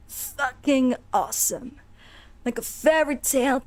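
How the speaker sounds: rasping zombie. Acted. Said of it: "that's how he's gonna talk".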